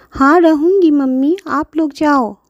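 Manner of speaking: put-on child voice. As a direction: answer like a little girl.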